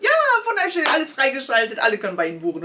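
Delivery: high, bright mock website chirp; cheery website voice. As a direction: chirp it like a cheerful booking site. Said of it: "da stand".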